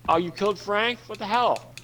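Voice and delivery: high-pitched, playful indignation; mock outrage. Friend reacting to news of a killed plant, mock-outraged.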